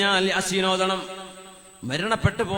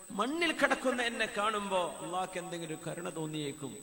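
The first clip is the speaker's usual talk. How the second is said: dying man's faint voice; 11 dB quieter, faster than usual. Acted. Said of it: "Umar എന്നവരുടെ വസിയത്താണ്".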